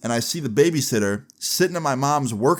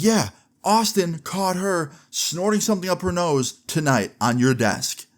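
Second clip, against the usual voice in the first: high-pitched, slow, drawn-out telling-on; emphatic accusation; 7 semitones higher, slower than usual. Retold or imitated. Oldest brother exposing babysitter to mom, acted emphatic accusation. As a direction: spell it out pointedly, imitating a brother.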